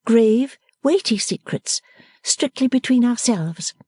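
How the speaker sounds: playful daughter fussing at her father; Bella, young daughter. A narrator's reading.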